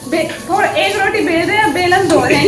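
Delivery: high, loud laughing gripe; giggly mock outrage; laughing complaint. Real, not acted. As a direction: tease him while laughing at the mess. Laughing complaint about husband's cooking.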